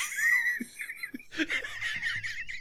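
high, wheezy helpless laugh. Real, not acted. Reader realizing the accent has changed, breaking up laughing. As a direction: collapse into squeaky laughter. Reader cracks up mid-email.

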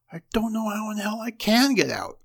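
exasperated. Acted. Husband angry, locked in attic.

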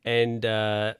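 ordinary talk

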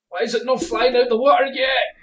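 high, loud comic complaint; mock exasperation. Gamer baffled by real fishing, mock exasperation. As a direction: cry out in pretend frustration at the rod. Acting a novice failing at fishing.

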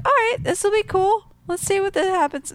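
high-pitched, noncommittal okay; cautious acceptance. Retold or imitated. Fan recalling her first reserved reaction, high and noncommittal. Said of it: "I was just like".

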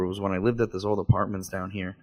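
usual speaking voice

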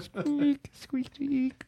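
tiny high squeaking hamster; hamster squeaks. Pretend. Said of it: "ominous hamster noises".

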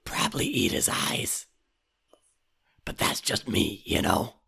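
whispered, hungry raven. Acted. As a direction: hiss it like a ravenous bird. S2 holds the Raven voice.

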